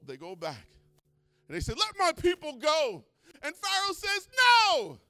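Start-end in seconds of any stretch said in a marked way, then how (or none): 1.7-3.0 high loud slow demand; Moses demanding. Acted. 4.4-5.0 high loud refusal; Pharaoh refusing. Acted.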